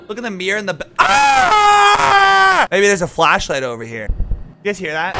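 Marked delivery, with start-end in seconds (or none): 1.0-2.7 sudden high scream of fright; startled scream. Real, not acted. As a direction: jump out of your skin. Sudden jump scare in game.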